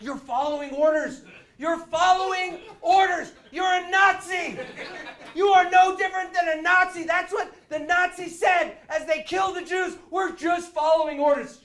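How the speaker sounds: high, very loud accusing yell; retold furious tirade, climbing to a scream; outraged shouting. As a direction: hurl the accusation at full volume. Acted outraged accusation at phone agent.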